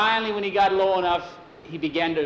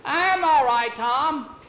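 calling down; 10 semitones higher, 3 dB louder, slower than usual. Acted. Calling down from broom.

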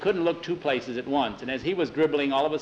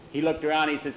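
usual speaking voice

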